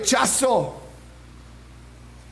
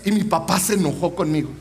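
ordinary talk, nothing marked

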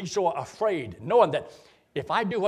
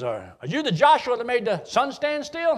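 stern judge; 8 semitones higher, 5 dB louder than usual. Acted. Judge questioning Joshua in joke.

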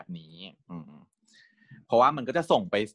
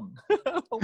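laugh. Real, not acted. Laughing mid retelling.

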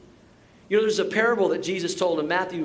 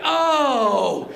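loud, high, long oh; mock horrified realization; 7 semitones higher, 6 dB louder than usual. Golfers learning he is a pastor, hammed-up horrified gasp. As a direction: imitate someone mortified at their own blunder.

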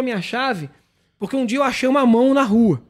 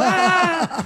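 crowd laughing reaction; 8 semitones higher than usual. Retold or imitated. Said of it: "Aí os crentes tudo".

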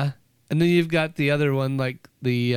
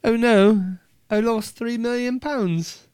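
slow, higher-pitched mock-British dismay; English accent; 6 semitones higher, slower than usual. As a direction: join in with a put-on English accent. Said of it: "accents just make everything better".

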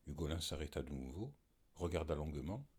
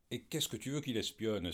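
mild exclamation; 6 semitones higher, 5 dB louder than usual. Acted. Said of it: "s'écria Philoxène".

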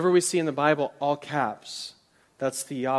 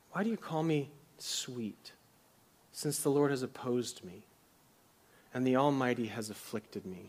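quiet lament; 3 semitones lower, 7 dB quieter than usual. Acted. Preacher reading Naomi's words quietly.

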